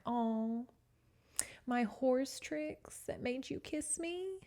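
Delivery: slow, high mocking sneer. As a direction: taunt him by drawing out his words. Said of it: "she just mocks him the whole time".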